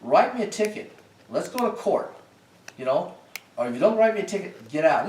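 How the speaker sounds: high, loud, indignant challenge; retold upset outburst. Driver refusing to calm down, daring the cop to ticket him, angrily. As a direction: relive losing your temper, pitch jumping right up. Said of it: "I was so upset".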